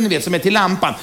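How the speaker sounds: ordinary talk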